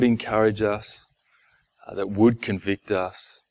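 hushed, low-key prayer, even and unhurried. Real, not acted. Leads the congregation in prayer.

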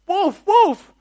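high, loud pretend cry for help; hammed-up high yell; mock alarm cry. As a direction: call out like a prankster raising the alarm. Acting the boy crying wolf.

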